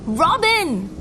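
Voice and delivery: high, loud anguished scream. A narrator's reading. Man sees the body carted away, screaming. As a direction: scream a loved one's name. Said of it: "I screamed".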